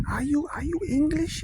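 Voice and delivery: put-on old German man. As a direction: pitch it up high as the delighted old man. Old man asks the lads.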